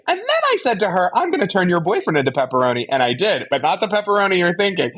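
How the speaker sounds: high, fast chirpy Anya impression; quick high imitation of Anya babbling; monologuing Anya. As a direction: rattle it off like a cheerful Anya. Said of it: "Anya is just monologuing like".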